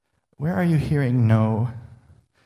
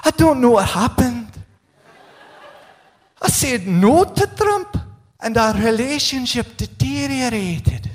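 high, hammed-up Scottish politician voice; Scottish accent; 9 semitones higher than usual. Preacher quotes a rattled Scottish politician, Scottish accent. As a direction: mimic a rattled Scotsman in a broad Scottish accent. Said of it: "I wanted to speak in a Scottish accent".

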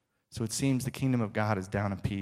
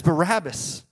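a higher, quick-talking Pilate; Pilate questioning; 5 semitones higher than usual. Retold or imitated. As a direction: answer the mob as a puzzled governor. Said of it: "But Pilate says".

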